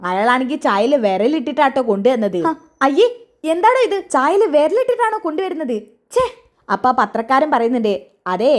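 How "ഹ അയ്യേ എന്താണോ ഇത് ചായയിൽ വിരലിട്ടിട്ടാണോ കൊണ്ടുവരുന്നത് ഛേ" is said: disgusted. Acted. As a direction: recoil at the dirty tea. Disgust at finger in tea. (അറപ്പോടെ ഉയർന്ന ശബ്ദത്തിൽ)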